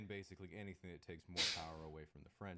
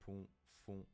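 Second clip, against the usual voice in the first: French pronunciation. Shown as a demonstration. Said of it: "in French you have".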